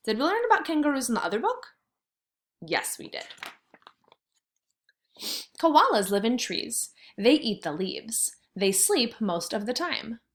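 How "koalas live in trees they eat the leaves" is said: usual speaking voice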